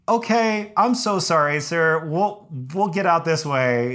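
high, placating, deferential voice; meek apology. Retold or imitated. Hiker promising landowner to leave, meekly apologetic. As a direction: act out anxious-to-please backing away.

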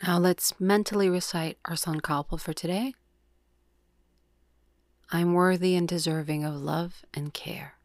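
calm, measured yoga nidra guide; soothing meditation voice, words evenly spaced; guided meditation. Acted. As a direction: lead the breath with long, unhurried pauses.

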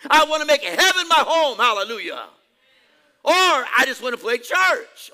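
high, very loud impassioned preaching; full-force preaching. Real, not acted. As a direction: shout it out to the whole church.

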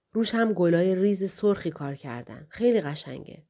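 ordinary delivery

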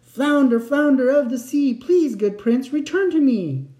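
high, loud summoning call. A narrator's reading. Fisherman summoning the flounder from the shore, calling out. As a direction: call out across the sea. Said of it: "The fisherman called".

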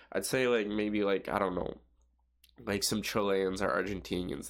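ordinary talk

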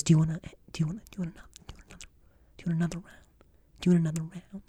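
hushed waitress question, almost silent; whisper. Retold or imitated. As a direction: mouth it across the dark room. Waitress whispering across the room.

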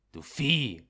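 laut, höher, voller Zorn; wütender Schrei. Acted. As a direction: schrei ihn empört an. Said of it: "schrie ich wütend". (der Arzt schreit den Knecht wütend an)

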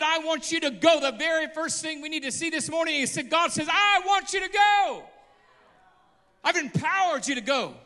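loud, high preacher cry; preaching at full force. Acted. Preacher urging the church to go out, shouting. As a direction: thunder it to the congregation.

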